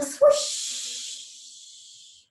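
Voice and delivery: long hushing whoosh of breath; swooshing exhale; exhaled swoosh. Shown as a demonstration. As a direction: breathe out on a drawn-out "shhh".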